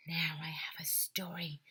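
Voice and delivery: breathless eager whisper; a reporter hushing her thrill; excited whisper. Acted. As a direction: whisper it like a secret scoop. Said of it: "she said excitedly".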